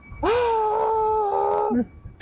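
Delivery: put-on long high crying wail of a kid; mock endless child wail; imitated long child's wail. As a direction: hold a drawn-out toddler scream. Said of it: "that kid took a".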